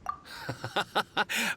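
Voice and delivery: a soft embarrassed laugh; flattered chuckle. Acted. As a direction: laugh off the teasing. Merchant flattered by remark.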